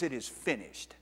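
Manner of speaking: final quiet words of Jesus on the cross; hushed last words. Acted. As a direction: let it fall away into quiet.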